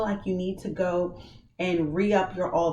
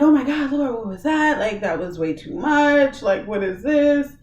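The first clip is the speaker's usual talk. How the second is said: much louder, higher startled outburst; loud stunned cry to the Lord; shocked waking; 6 semitones higher, 6 dB louder than usual. Retold or imitated. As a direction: gasp it out after a nightmare. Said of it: "And I'm like".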